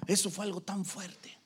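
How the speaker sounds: ordinary talk, nothing marked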